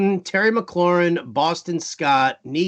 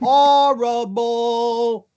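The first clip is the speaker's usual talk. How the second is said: horrible in long held syllables, pitched up; drawn-out mock disgust; 5 semitones higher, 3 dB louder, much slower than usual. Mocking the Giants secondary, horrible drawn out slowly. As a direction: stretch horrible out absurdly. Repeats the insult, exaggerating each time.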